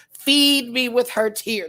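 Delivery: milking it as a joke, drawn out; mock dramatic demand. Joking to chat about pizza money, mock-dramatic. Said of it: "No, I'm just playing".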